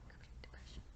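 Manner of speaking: breathy whispered aside. Real, not acted. Whispering the phrase to her partner. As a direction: whisper it to yourself.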